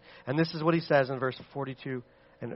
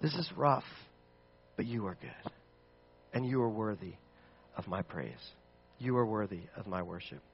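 soft, low, humbled prayer voice; softly crying out to God; quiet prayer; 6 semitones lower, 8 dB quieter than usual. Acted. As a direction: pray it gently, sinking lower. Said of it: "crying out to God and saying".

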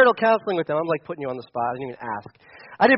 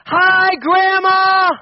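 high, loud, slow greeting; greeting a hard-of-hearing great-grandma loudly; yelling to grandma; 11 semitones higher, 7 dB louder, much slower than usual. Retold or imitated. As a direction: shout it slowly so she can hear. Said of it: "you'd have to yell her".

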